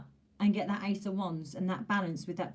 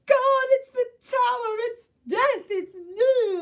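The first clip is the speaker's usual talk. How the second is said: panicked; 7 dB louder, slower than usual. Retold or imitated. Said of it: "A lot of people are like".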